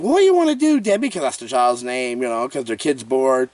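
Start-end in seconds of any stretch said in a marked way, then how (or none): 0.0-1.1 imitating mom. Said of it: "the mom's like".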